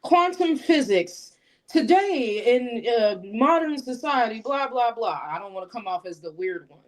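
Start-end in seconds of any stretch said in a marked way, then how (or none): 0.0-5.2 slow, high know-it-all blurt; hint of a geeky lecturer; lightly voiced random-fact rambling; mock nerdy rant. Shown as a demonstration. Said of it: "blurt out something completely random".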